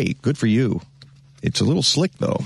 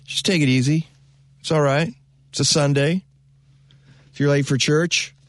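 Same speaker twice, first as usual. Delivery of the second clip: ordinary talk, nothing marked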